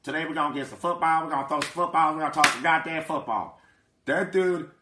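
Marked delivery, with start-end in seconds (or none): 0.0-3.6 put-on football coach voice; mimicking a coach announcing football talk; Chan Gailey impression. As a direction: talk like a folksy coach, in a changed timbre. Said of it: "that's my Chan Gailey voice".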